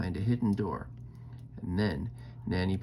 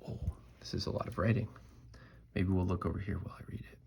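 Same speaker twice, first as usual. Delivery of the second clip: whispering; 2 semitones lower, 7 dB quieter, much faster than usual. Real, not acted. Aside about lots of writing.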